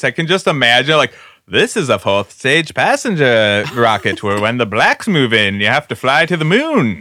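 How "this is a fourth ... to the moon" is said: put-on old-fashioned newsreel narrator voice; old-timey voice. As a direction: announce it like a fifties educational film. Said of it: "the old-timey voice".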